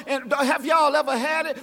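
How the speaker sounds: usual speaking voice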